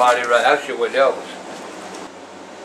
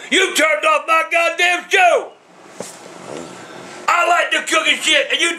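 angry shout; 5 semitones higher than usual. Real, not acted. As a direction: rage about the lost TV show. Dad yelling at Comcast agent.

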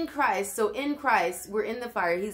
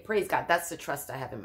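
usual speaking voice

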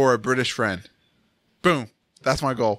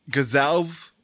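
normal talking voice

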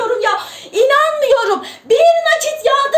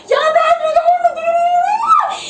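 high-pitched, climbing to a squeal; excited disbelief; 5 semitones higher than usual. Real, not acted. Streamer amazed by the growing audience, excited squeal. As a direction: let it rise into an amazed squeal.

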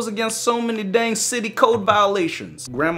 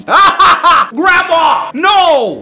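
loud, high yells, half laughing; laughing panic shouts; 9 semitones higher, 10 dB louder, much slower than usual. Real, not acted. Gamer caught by grandma at night, laughing panicked yells. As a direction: shriek and laugh as grandma lunges. Grandma grabs him outside.

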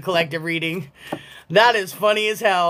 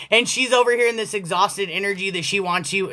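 ordinary talk, nothing marked

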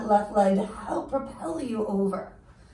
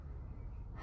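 audible sigh; 18 dB quieter than usual. Shown as a demonstration. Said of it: "sigh it out".

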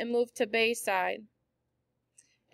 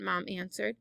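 plain reading voice

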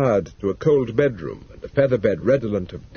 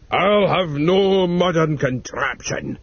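put-on old man refusing; 7 semitones higher, 4 dB louder, slower than usual. Old man refuses, voiced role.

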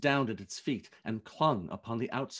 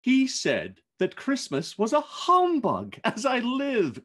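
higher, louder laughing nephew; nephew crying out; 10 semitones higher, 6 dB louder than usual. Acted. Fred laughing about Uncle Scrooge, higher and louder. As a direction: relish the joke about his uncle. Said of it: "cried Scrooge's nephew".

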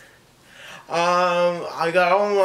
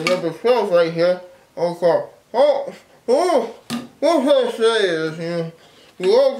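words muffled by gumballs; talking around stuffed cheeks; mouth full of gumballs; 3 dB louder than usual. Real, not acted. Seen 3: Cheeks bulge, gumball visible between lips; he adds more at 4.2.

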